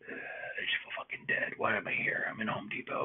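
whispered, drained and defeated; worn-out whisper. Retold or imitated. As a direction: whisper it like you're dead tired. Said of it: "I'm just like".

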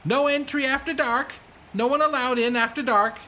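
put-on high, hurried boy's voice; fast young gatekeeper. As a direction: blurt it out fast like a nervous young guard. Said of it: "you just hear a fast".

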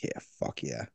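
whispered, low fuck yeah; whispered quote. Retold or imitated. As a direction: breathe it out under your breath. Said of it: "I'm like".

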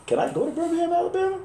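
pitched very high, rising in disbelief; incredulous question. Retold or imitated. Coach hears about job in Alabama, squeaky disbelief. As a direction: sound stunned at being sent south. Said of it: "I said".

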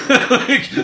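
high, loud burst of laughter. Real, not acted. Laughs at email pile.

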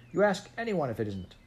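put-on high, challenging child's protest; insistent child. A narrator's reading. Jane challenges the woman over the carpet, insistent.

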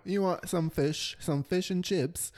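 drawn-out hammed-up British offer; mock British accent. Offering fish and chips as a joke, mock British accent.

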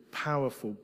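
ordinary talk, nothing marked

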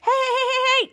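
high, rapid string of protest; mock yell to stop someone, pitched well up; retold yelling. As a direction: imitate an alarmed kid calling out. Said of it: "all the kids were yelling at him".

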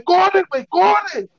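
high-pitched urgent call; urgent retold shout. Telling his friend to run from the police, urgent. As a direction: call it out high, urging him to flee.

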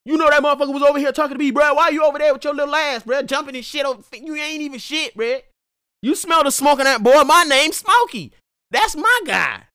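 high, loud, fast trash talk as a dog; jealous dog voice. Acted. Smokey the dog mouthing off at a rival dog, high and loud. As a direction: voice the dog Smokey, rattling off insults. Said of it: "started barking at the other dog. Like".

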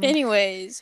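normal talking voice

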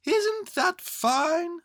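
high, loud, very slow call; shouted down. A narrator's reading. Bear up high to boy below, slow high shout. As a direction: stretch it out, calling from high up. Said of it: "shouted Winnie the Pooh down to you".

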